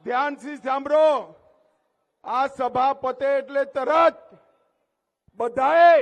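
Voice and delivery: loud instruction to a crowd; forceful rally command; calling for attention. Real, not acted. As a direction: project it to the back rows.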